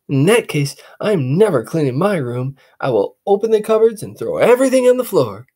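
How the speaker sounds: put-on boy voice, quick and stubborn; boy refusing to clean his room; defiant boy. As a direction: dig in like a defiant kid.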